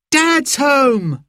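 put-on high, thrilled child's cry; excited shout. A narrator's reading. Boy spots Mum's car, excited high shout. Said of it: "shouted Simon".

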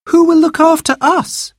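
put-on very high worried child's voice; anxious little girl. Youngest child doesn't want mother to go, high anxious plea.

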